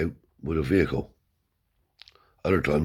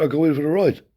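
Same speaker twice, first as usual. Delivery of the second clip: much higher, louder push to come; won't take no; father insisting; 10 semitones higher, 5 dB louder, faster than usual. Retold or imitated. Father insisting on the ride, raised high voice.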